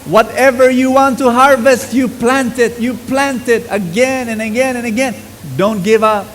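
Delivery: high, loud, slow rallying preach; preacher urging the congregation not to give up; full-force preaching. Acted. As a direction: rouse the congregation, drawing words out.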